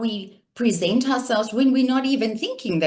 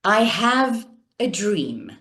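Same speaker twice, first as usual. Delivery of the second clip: slow oratorical cadence, pausing before "a dream"; Martin Luther King; slower than usual. Shown as a demonstration. Coach quoting a famous preacher's speech, slow cadence. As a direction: draw out "I have", then let "a dream" land. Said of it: "Martin Luther King".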